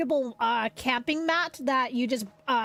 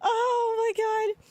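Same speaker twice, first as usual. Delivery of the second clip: high, drawn-out exasperated whine; so mad; 9 semitones higher, slower than usual. Real, not acted. Streamer laments missing the voice actress, high mad whine. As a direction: lament it slowly in a high pitch. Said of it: "I'm so mad".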